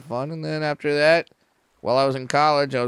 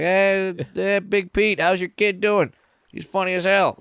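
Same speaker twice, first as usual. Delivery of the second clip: hearty, higher buddy voice calling out; office buddy; 6 semitones higher than usual. Retold or imitated. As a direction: boom out the praise like a pal at the office. Dad's office buddies praising him.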